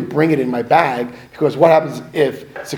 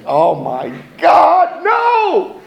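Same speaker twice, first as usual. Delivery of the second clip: slow, loud shocked cry rising high; mock horror; 4 dB louder, much slower than usual. Pilot imitating himself when captain mentions his drunk night, mock horror. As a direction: act out being aghast. Retelling his horrified reaction.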